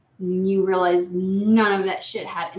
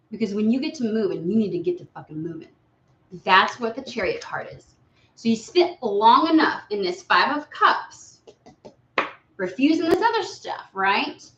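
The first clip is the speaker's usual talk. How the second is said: ordinary talk, nothing marked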